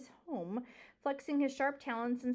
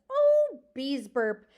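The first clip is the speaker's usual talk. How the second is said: scoffing; 3 semitones higher, 12 dB louder, much slower than usual. A narrator's reading. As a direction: brush it off loudly. Aerith scoffs at mouse.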